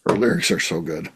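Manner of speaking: low, soft mocking repeat; unimpressed imitation of a fan; deadpan echo. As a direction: parrot the praise back dryly, low and quiet. Dryly echoes the fan's praise.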